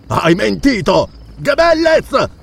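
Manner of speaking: roaring. A narrator's reading. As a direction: roar the accusation and call your servant. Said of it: "ruggì Valenzo".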